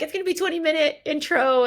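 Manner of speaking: fast and high, imitating her earlier self; mock excited self. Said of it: "I was literally like".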